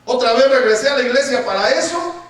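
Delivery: loud, high, indignant grumbling; mock complaint. Preacher imitating believers complaining to God when things go badly, mock indignant complaint. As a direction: protest loudly like a let-down believer. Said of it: "cuando no nos salen bien las cosas y decimos".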